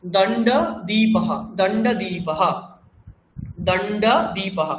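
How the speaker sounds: দণ্ড দীপহ slowly, long দী and sounded final হ; Sanskrit pronunciation. Shown as a demonstration. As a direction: model দণ্ড দীপহ word by word, ending on a breathed ha. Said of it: "সংস্কৃতে কি বলবো?". (সংস্কৃত উচ্চারণে ধীরে দণ্ড দীপহ)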